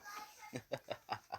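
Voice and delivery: soft, trailing chuckle; put-on villain's snicker; acted villain laugh. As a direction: laugh quietly to yourself, mockingly. Villain keeps laughing in story.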